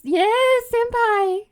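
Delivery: high, loud fangirl squeal; excited anime fan. Retold or imitated. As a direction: scream it like a devoted weeb. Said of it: "sincere senpai screaming".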